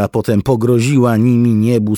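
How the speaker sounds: plain reading voice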